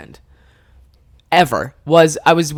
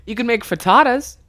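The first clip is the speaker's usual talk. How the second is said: higher-pitched suggestion; imitating helpful friend; 7 semitones higher than usual.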